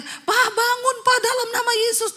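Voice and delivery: high pleading cry to wake him; wife crying out. Retold or imitated. Wife calling collapsed husband to wake, high cry. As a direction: call out to him in panic. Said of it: "Istrinya teriak".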